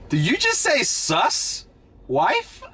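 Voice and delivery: high, drawn-out disbelieving question; mock-baffled, rising on the last word; incredulous. Retelling a baffled reply to a Dominican speaker, incredulous. Acted reaction to hearing the phrase.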